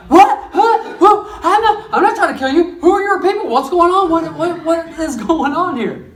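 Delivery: a guilty man caught flat-footed, babbling; panicked Haman. Acted. Haman denies it to the queen, panicking. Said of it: "and he's like".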